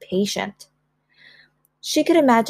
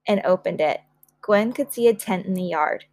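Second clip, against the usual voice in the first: usual speaking voice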